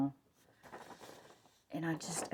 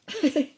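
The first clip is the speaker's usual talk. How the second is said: short, high, loud giggle; laugh; 11 semitones higher, 9 dB louder than usual. Real, not acted. Excited crafter worrying about having enough time, laughing. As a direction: burst into an excited little laugh.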